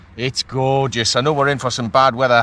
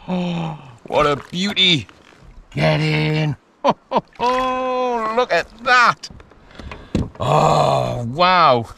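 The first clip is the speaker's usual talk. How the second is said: high, excited gasps of delight; thrilled; 5 semitones higher, 3 dB louder, much slower than usual. Real, not acted. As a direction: let the joy spill over, much higher. Thrilled by the catch.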